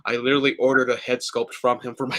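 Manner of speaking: ordinary talk, nothing marked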